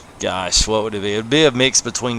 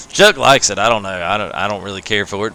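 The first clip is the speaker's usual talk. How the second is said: ordinary delivery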